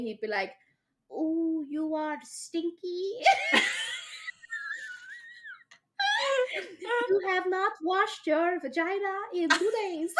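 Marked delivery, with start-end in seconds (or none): 1.1-10.1 slow, high, silly put-on voice; mock boyfriend. Imagined boyfriend answers a dirty talk request in a silly high voice. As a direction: ham up the clumsy boyfriend.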